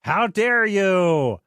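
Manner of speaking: put-on high, slow, outraged old hag. Podcaster voicing the old hag scolding the heroes, high and slow. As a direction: drag out the hag's indignation, pitched high. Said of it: "the old hag is like".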